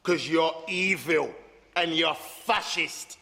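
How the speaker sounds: slow and deliberate. Acted.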